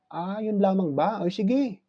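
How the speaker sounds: high, slow, dopey voice; silly simple Juan. Acted. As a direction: play the gullible friend, pitched high and drawn out. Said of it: "sabi ni Juan ngayon".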